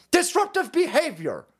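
"Disruptive behavior" thrown out high and sneering; mock the slogan in a high, sneering voice. Mocking the shirt slogan.

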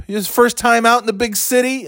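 high-pitched mocking question; mocking jeer. His line for stupid drivers, high mocking jeer. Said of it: "that's my line for any time anyone".

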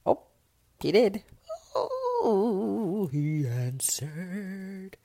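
high, surprised "oh", then drawn out slowly. Real, not acted. Woman reading a text she hoped for, surprised and slow. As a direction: caught off guard that he answered. Checks phone, he replied.

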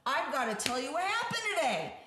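eager teacher. Retold or imitated. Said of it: "this teacher's like".

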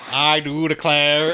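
loud mock Boston presidential accent; hammy Boston accent; John F. Kennedy. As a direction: imitate a failed accent as Boston oratory. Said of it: "you sounded like John F. Kennedy".

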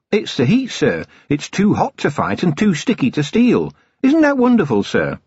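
put-on high, eager young voice; bright, dutiful junior officer; young dwarf sergeant. A narrator's reading. Cheery chatty report.

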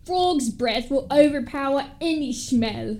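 exaggerated Cockney character read; stupid Cockney accent. Sally picking up the frog's breath jar, Cockney accent. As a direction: put on a butchered Cockney. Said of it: "let's do the stupid Cockney one".

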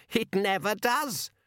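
put-on slow, high old woman cackle; cackling. A narrator's reading. Old woman cackling at a joke. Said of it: "cackled the old woman".

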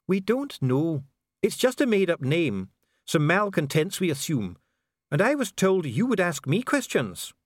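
defensive official Dee. Acted. Official answers investigator about malcontents, defensive. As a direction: account for yourself as an official.

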